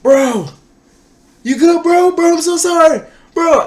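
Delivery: very high, loud frantic shouting; panicked yelling. Retold or imitated. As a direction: yell in a panic at the fallen friend. Friend knocked down, panicked yelling.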